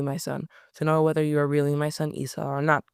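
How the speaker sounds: ordinary delivery, nothing marked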